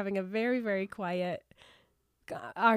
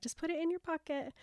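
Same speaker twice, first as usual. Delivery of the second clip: put-on tender to kids; 6 semitones higher, 7 dB quieter, faster than usual. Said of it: "I'm like".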